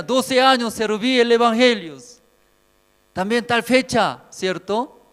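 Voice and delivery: loud, high-pitched declaiming; boastful petitioner to God. Acted. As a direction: proudly call out your record to heaven. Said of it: "Cuando así hablando, Dios, ¿qué dirá?".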